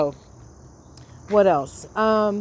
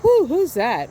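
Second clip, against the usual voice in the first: surprised whoo; 6 semitones higher, 5 dB louder than usual. Retold or imitated. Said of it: "all of a sudden you're like".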